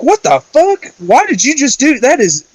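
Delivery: high-pitched, indignant mock outburst; retold outrage. Wife to guest who stamped out a cigarette, outraged. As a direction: imitate a wife appalled at a rude guest.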